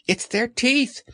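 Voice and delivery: high, serious little voice; high, earnest piglet. Acted. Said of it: "said Piglet earnestly".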